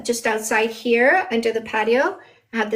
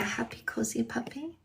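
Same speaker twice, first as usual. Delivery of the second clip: whisper; 12 dB quieter than usual. Real, not acted. Whispering to show sleeping puppies.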